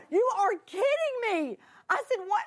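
high, loud delighted disbelief; excited squeal. Retold or imitated. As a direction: squeal with joy at the news. Excited reaction on the phone.

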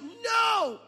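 very high, loud shouted "No.". Retold or imitated. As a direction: yell it flat out. Wife shouting no.

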